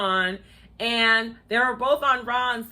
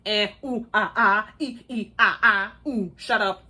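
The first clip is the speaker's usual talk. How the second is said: mock gushing squeals; 2 semitones lower than usual. Mocking Kyra's gushing noises.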